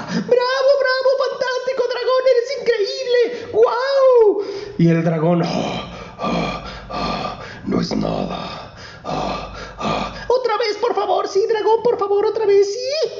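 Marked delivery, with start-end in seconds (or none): 0.0-4.4 very high delighted squeals; cheering princess. Acted. 5.5-10.1 very low, soft, slow modest grumble; bashful dragon. Acted. Tired dragon mumbles modestly. 10.3-13.1 very high, eager little princess. Acted. Princess begging again.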